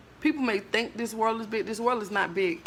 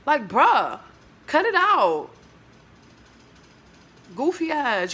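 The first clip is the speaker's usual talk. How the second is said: exasperated bruh; 4 semitones higher, 3 dB louder, slower than usual. Real, not acted. Scolding the driver.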